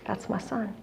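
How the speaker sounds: hushed, deeply felt recognition; heartfelt realization. Retold or imitated. Mother at the roadside feeling for a stranger's boy, hushed. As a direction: let it land very softly.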